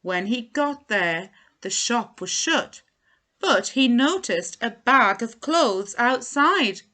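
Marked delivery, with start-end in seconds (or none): none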